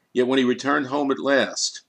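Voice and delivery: plain reading voice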